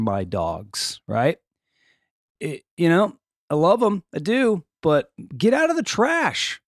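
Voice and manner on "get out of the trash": high-pitched dog-owner reprimand; exasperated scolding. Acted. Owner to dogs in the trash, exasperated scolding.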